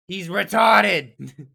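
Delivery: very loud, slow, drawn-out scream; hysterical shout. Pretend. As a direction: bellow it in a hysterical rage. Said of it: "You play the battered girlfriend".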